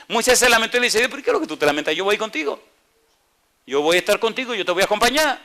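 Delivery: high, loud preaching of God's promise; preaching at full force. Acted. Preacher voicing God's promise to Moses, loud and high. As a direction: proclaim the promise with fire.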